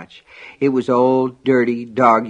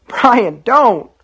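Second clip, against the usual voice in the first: high, loud plea to stop; panicked boy, near tears; frightened cry; 12 semitones higher, 7 dB louder than usual. A narrator's reading. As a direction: cry out to halt your brother. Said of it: "Sean cried".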